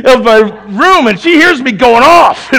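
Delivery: loud, high, exasperated storytelling; worked-up preaching. Real, not acted. Preacher telling the congregation about his frozen computer, worked-up preaching. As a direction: pour out the computer woes at full volume. Preaches a heated anecdote loudly.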